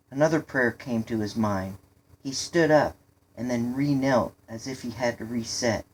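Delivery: ordinary delivery, nothing marked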